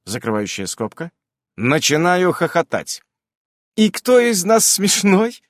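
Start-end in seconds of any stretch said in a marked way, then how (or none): 3.8-5.4 high, slow, louder offence; huffy pout. A narrator's reading. Nasa takes offence at being laughed at, high huffy voice. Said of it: "надувается Наса".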